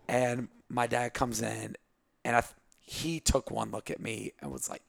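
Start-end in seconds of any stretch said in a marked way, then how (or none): none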